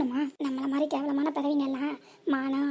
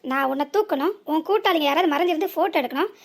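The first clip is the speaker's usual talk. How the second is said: furious accusation; 5 dB louder than usual. Acted. Driver rants at the woman about her blackmail plan, furious. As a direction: lay out her whole scheme in outrage.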